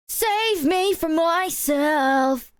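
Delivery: mock edgy plea. Mock lyric in the cringe song, dramatic. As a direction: drag out a melodramatic plea. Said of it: "so obviously just".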